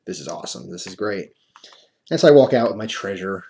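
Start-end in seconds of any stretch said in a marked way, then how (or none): 0.0-1.3 quiet glee. Retold or imitated. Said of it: "I'm like".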